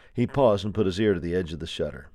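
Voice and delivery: plain reading voice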